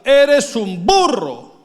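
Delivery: high, loud, slow scornful father; mock angry father shout; harsh father. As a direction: bellow it like a contemptuous dad. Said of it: "mi papá me decía".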